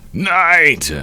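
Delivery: high, drawn-out cry of a name; anguished cry. Acted. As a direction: cry out for a lost friend. Character cries out for fallen friend.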